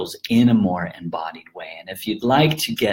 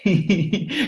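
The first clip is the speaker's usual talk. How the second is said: laughing; 8 semitones higher than usual. Real, not acted. Laughing after the chant.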